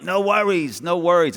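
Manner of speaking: high, drawn-out no worries; lightly mimicking a laid-back Aussie; mock Aussie. Imitating Aussies' catchphrase.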